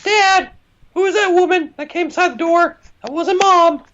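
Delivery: put-on squeaky high child calling for dad; high-pitched child voice asking dad; whiny little kid. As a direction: play a needy little child begging dad for answers.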